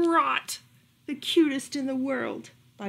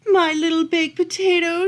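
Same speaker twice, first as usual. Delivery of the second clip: cooing; 7 semitones higher, 7 dB louder than usual. Acted. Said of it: "she cooed".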